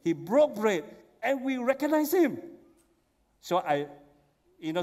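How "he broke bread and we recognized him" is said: excited retelling. Acted. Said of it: "He said".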